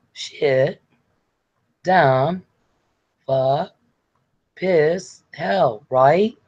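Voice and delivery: hesitant swearing, one word at a time; Sarah trying to swear. Acted. Sarah trying out curses for Les, halting and polite. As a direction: imitate a polite attempt at cursing, questioning lifts. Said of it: "No, Sarah".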